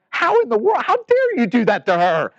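high, indignant mock outrage; outraged. Congregation scolding the pastor for a dutiful gift, high outraged mock indignation. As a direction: squeal it out in shocked disbelief. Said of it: "Monica would say".

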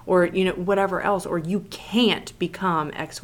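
usual speaking voice

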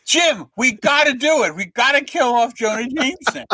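high, eager retold plea; excited pitch. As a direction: bounce with excitement. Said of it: "I'm jumping up and down".

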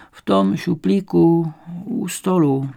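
put-on slow, effortful old woman; old woman's voice. A narrator's reading. Old woman pointing detectives to the drawer, slow old voice. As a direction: answer slowly in an old woman's voice.

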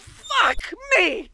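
high startled shout. Acted. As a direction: jump and yell in fright. Startled by friend.

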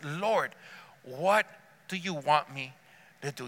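soft, halting appeal to God; quiet, submissive prayer; hushed surrender. Acted. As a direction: say it low, pausing between phrases. Models a surrendered response to God.

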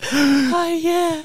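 high mock moan through laughter; laughing moan. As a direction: moan it while cracking up.

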